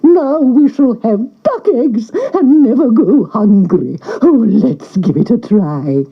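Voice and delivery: put-on slow, quavering old woman, pleased. A narrator's reading. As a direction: dream of eggs like a greedy old woman. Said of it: "she said".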